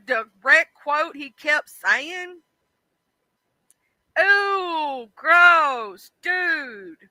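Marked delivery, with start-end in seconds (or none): 4.2-7.0 disgusted. Real, not acted. Disgusted at the quote.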